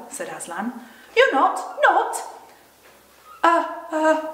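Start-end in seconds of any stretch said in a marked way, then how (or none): 1.1-2.2 high, loud, halting question; acted girl too scared to finish; fearful faltering. Shaken Susan acted in story. 3.4-4.2 high, loud faltering stammer; stammer, unable to get the word out; acted frightened shakiness; shaky voice. A narrator's reading. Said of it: "Susan, in a shaky voice".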